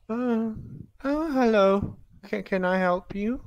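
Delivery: lightly voiced shy woman; a hint of a timid shopkeeper; fortune teller voice.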